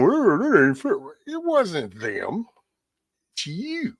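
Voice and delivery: high, far-off whine that turns on itself; mock indignation. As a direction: whine at the unfairness, then answer yourself. Mock self-pity then rebuke.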